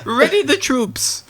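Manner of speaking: high, slow royal battle order; mock Queen. Pretend Queen calling up her army, high slow voice. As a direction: draw the command out in comic falsetto.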